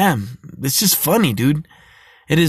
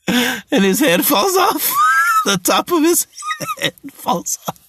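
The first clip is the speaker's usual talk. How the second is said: high, laughing words; laughing through it; slower than usual. Real, not acted. Laughing while telling viewers the head falls off.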